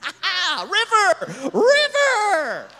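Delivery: high, loud laughing whoop into drawn-out shouts; cackle, then shout it high and loud; excited cry. Retold or imitated. Woman exulting over her River van, excited cry.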